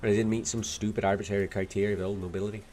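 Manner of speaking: put-on Irish accent with a Scottish lilt; attempted Dublin accent. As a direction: do a not-very-good Dubliner. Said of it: "Scottish lilt out of the attempt at an Irish accent".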